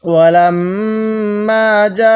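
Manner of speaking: ওয়ালাম্মা যা with the যা drawn out long; four-alif madd. Shown as a demonstration. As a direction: stretch যা over four counts. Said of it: "চার আলিফ টান দিব". (যা চার আলিফ টেনে পড়া)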